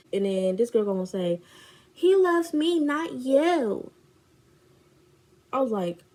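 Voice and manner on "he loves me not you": high, loud put-on taunt; mock taunting friend. Friend taunts her about him, mock taunting. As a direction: mimic a rival's boast.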